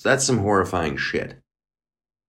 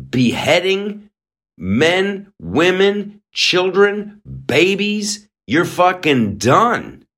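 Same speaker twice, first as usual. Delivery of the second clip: slow, pitched-up outrage; a raised, slow, outraged list of victims; furious, higher and building; 8 semitones higher, slower than usual. Real, not acted. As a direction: hammer each word of the list, enraged.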